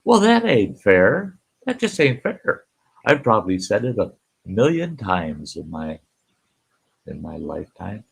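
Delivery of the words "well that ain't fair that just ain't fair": mock whiny complaint. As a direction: imitate someone griping. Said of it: "you're gonna go".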